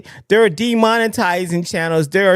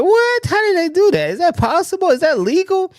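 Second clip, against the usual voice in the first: shocked disbelief; 13 semitones higher than usual. Retold or imitated. Vlogger retelling his shock at a channel ban, high incredulous squeal. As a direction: pitch leaps way up in stunned disbelief. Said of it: "I was like".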